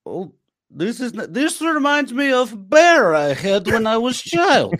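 high, slow mock Russian; Russian accent. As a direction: reminisce in a heavy Russian accent. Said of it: "Russian thrift shopping".

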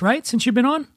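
ordinary talk, nothing marked

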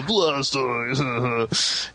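mock crying creature voice; Blastoise. Host spoofing the film's saddest part, mock crying. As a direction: moan the name in fake sorrow. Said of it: "making fun of, like, the, probably the saddest part".

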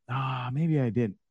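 ordinary talk

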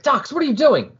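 high, alarmed demand; startled squeal at a reckless pilot. Retold or imitated. Strata alarmed at Dox, voice jumping high. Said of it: "and Strata's like".

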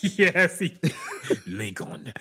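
laughing, mimicking the trailer's African accent on "Lincoln", rising at the end; mocking the African accent from the trailer, laughing. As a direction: parrot "Lincoln" in the actor's African accent through a laugh, pitch going up. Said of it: "Why the fuck he say it like that".

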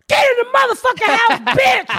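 high yelled command; shouting. Retold or imitated. Ordering the girl into the house, high shout. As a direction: yell it high at the kid. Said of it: "I said".

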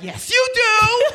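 high, slow, loud sassy comeback; sassy retort. Retold or imitated. Rider contradicting the cab driver, a slow sassy retort. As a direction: drag it out, daring him. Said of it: "The cab driver was like".

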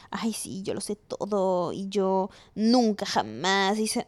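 a hint of smug know-it-all, slow; mock know-it-all. Host mocking how listeners might see her, smug and slow. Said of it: "puede parecer que yo".

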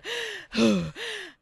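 a high, hammed-up gasp like a winded man; mock gasp for breath. Mocking the winded ghost hunter with a high, hammed-up gasp. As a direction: gulp air like you can barely breathe, mocking him. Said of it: "He is so out of breath throughout this whole thing".

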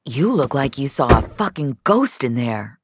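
lightly voiced Reza, teasing; joking. A narrator's reading. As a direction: rib her like an old coworker. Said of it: "Reza said jokingly".

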